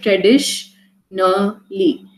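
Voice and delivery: "Traditionally" slowly in three parts, tradish, na, li; split into syllables. Shown as a demonstration. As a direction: break "Traditionally" into separate sounds with pauses between. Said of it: "different sounds that this word constituted of".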